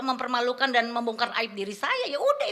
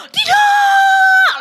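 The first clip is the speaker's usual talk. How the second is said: piercing high scream of refusal; anguished shout; 17 dB louder than usual. Retold or imitated. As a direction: scream no with everything you have. Refusing to forgive father. (teriakan menolak, sangat keras dan tinggi)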